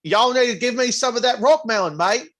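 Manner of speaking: hammed-up Southern-British hybrid accent; Southern-British accent blend. Shown as a demonstration. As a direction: attempt British with a Southern twang. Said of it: "do it in a Southern accent, but still keep the British accent".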